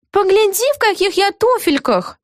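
indignant. A narrator's reading. Aggie refusing to break down the door, high indignant voice. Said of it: "возмутилась Эгги Хуф".

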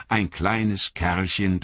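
ordinary delivery, nothing marked

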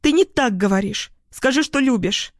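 high, demanding protest; shouting. A narrator's reading. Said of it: "кричит она". (требовательно выкрикивая)

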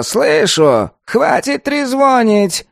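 high, slow, creaky golem; grumpy creaky tree creature. A narrator's reading. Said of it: "раздаётся голос голема Кирка".

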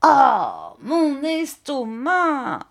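high, very drawn-out wail; old man's cry. A narrator's reading. Old man throws the jacket down, drawn-out wail. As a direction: cry it slowly, swelling then falling. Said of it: "cria le vieillard".

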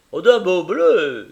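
loud, eager praise; delighted praise. Acted. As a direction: gush over the colour. Caleb praising the cloth.

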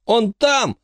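put-on high, tearful child's cry; boy cries for his friend through tears; choking on tears. A narrator's reading. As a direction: sob it out in desperation. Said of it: "захлёбываясь слезами".

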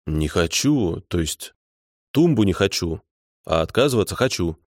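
slightly irritated, correcting himself; mild irritation. A narrator's reading. Petrovich refuses the caller, mildly irritated. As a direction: let a touch of annoyance show. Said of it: "с лёгким раздражением ответил Петрович".

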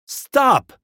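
very high, loud cry; screamed command. A narrator's reading. Brother trying to stop the horse, screaming. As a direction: scream it to halt disaster. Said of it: "screamed my brother".

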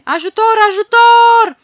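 screamed high and very loud; a terrified girl screaming for help; desperate cry for help. Acted. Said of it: "începe să strige cu deznădejde".

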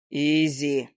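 low, understated stubborn insistence; lightly voiced stubborn man. A narrator's reading. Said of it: "упрямо повторил демон".

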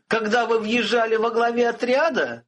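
high, frantic cry; panicked, losing control; shouting. A narrator's reading. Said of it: "закричал жрец".

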